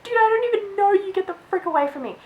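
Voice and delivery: high, fast, exasperated mock rebuff; retold annoyed outburst. Girl telling off a nosy boy, annoyed and high.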